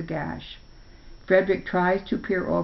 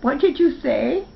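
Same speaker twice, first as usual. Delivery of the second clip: high, louder amazed cry; astonished; 8 semitones higher, 4 dB louder than usual. A narrator's reading. Spark amazed that his friend Chipper spoke, high and loud. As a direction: gasp it out in wonder. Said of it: "Spark is astonished".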